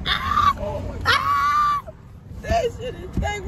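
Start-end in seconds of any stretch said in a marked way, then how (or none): 0.1-1.8 very high shrieks of disgust; scream. Real, not acted. In the stinking car, very high shrieks of disgust. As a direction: shriek at the smell.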